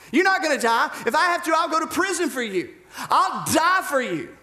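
high, impassioned escalating pledge; bold, brash vow. Acted. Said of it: "he's strong, he's brass, he's bold, he's alpha".

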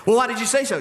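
put-on the child presses back; pestering child. Child voice in exchange.

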